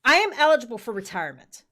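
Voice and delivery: firmly telling the captain she is eligible; insistent. Retold or imitated. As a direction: plant your feet and insist. Retold argument with captain.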